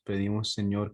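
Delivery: ordinary talk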